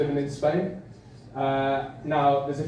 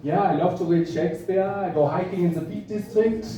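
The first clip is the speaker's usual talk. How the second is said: put-on higher-pitched comic German accent; 4 semitones higher than usual. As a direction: imitate a friendly German praising England. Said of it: "Germans will say".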